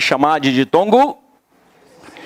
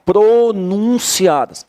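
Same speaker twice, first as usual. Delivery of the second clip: "Pronunciadas" slowed down and raised in pitch; key-word emphasis; 4 semitones higher, slower than usual. Shown as a demonstration. Teacher pointing out the key word, slow emphasis. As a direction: stretch "Pronunciadas" so it sticks. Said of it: "Essa é a palavra-chave".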